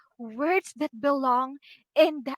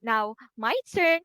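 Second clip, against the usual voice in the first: ordinary talk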